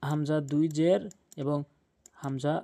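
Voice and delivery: ordinary talk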